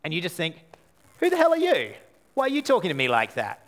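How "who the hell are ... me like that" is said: high-pitched offended snap, then settling; hammed-up indignation; indignant outburst. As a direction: play the stung friend bristling back. Indignant reaction to being called out.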